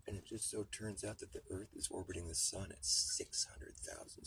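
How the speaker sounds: ordinary delivery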